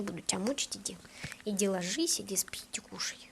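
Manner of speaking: soft whisper to the dog. Real, not acted. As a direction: gently whisper her away. Sending the dog away softly.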